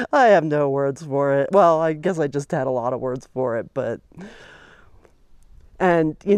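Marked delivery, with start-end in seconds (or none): 0.0-1.5 laughing. Real, not acted. Laughs at own frustration.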